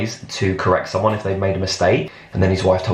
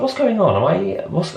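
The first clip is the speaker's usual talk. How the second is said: confused alarm; 9 semitones higher than usual. Retold or imitated. Retold confusion when turning around.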